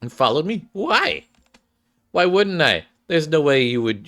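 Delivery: lightly surprised. Acted. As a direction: ask in disbelief. Surprised exchange, high voiced.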